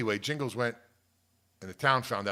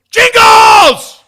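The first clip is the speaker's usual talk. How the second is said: sudden full-volume, high yell; startling shout; 29 dB louder than usual. Retold or imitated. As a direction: burst out yelling to startle. Reenacts shout that scares the goat.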